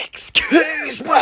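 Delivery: slow, high whispered "excuse-moi"; whisper-shout it so mum won't hear; stretched-out stage whisper of the meme; whispered catchphrase. Retold or imitated. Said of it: "tu veux que ma mère, elle vienne?".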